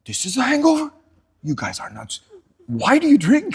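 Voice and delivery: mock outrage at his friends; incredulous disbelief. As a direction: protest in shocked disbelief. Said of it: "They were laughing their ass off".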